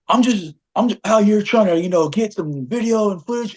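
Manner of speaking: high-pitched put-on pleading; imitation of a defensive objector; mock excuse. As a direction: mimic someone making excuses way up high. Retold quarrel, imitates man's excuse.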